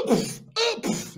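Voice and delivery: high grunt, then a puff for each blow; comic fight sound effects; imitated punching noises. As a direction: mimic the wife landing punches. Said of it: "Abby ist like".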